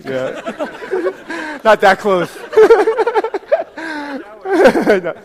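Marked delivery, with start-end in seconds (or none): 0.4-5.1 laughing. Real, not acted. Congregation crowding, laughing.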